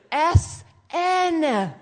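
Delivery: loud, high drawn-out letters; shouted spelling. Retold or imitated. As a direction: spell it out at the top of your voice. Spelling it out for deaf mom.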